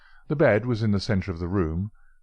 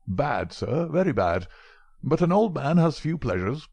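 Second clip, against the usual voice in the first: put-on an old scholar pressing his cigarettes on guests; old professor; 4 semitones higher than usual. Old professor offering Holmes cigarettes, higher genial voice.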